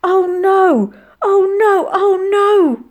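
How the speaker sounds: high, loud drawn-out wail; loud, anguished lament. A narrator's reading. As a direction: wail in horrified despair. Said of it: "wailed Molly".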